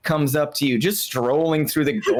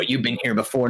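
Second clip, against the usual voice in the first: ordinary talk, nothing marked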